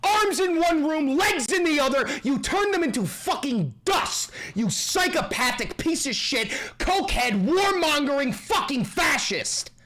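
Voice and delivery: shouting in raw fury, pitch climbing high; furious rant. Real, not acted. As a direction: hurl every accusation at him. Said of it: "I am so fucking angry".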